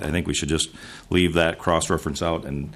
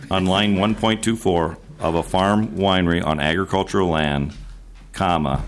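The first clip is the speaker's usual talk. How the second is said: slow, deliberate dictation with spoken punctuation. Real, not acted. Senator repeats amendment wording for the chair, slow dictation. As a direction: dictate it slowly for someone writing it down. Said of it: "Repeat it slowly".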